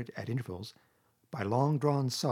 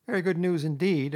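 high, louder doubtful echo; dubious; 7 semitones higher, 6 dB louder than usual. A narrator's reading. Rat doubting Toad's promise, dubious. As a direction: agree while clearly doubting it. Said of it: "observed the rat dubiously".

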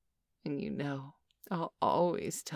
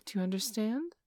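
usual speaking voice

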